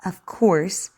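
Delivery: slow, clear story reading; over enunciating. Acted. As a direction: read to a child, carefully. Reads the story aloud for practice.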